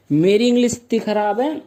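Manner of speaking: ordinary talk, nothing marked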